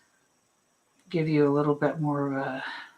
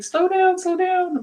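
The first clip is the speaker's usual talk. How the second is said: high, mock-pleading slow down; imitating viewers begging her to slow down; 12 semitones higher than usual. Art teacher quoting live viewers, high mock plea. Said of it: "and people were like".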